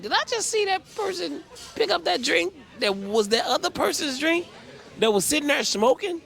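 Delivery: high, stunned, can't-believe-it question; shrill disbelief at what you just saw; shocked disbelief. Retold or imitated. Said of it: "I was like".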